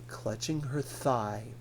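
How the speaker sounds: plain reading voice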